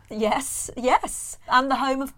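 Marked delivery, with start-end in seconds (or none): none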